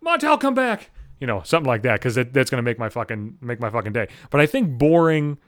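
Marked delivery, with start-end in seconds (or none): 0.1-0.9 high, loud yell after a runaway pet; acted scream, pitched way up. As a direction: call out at the top of your range. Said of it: "screaming out".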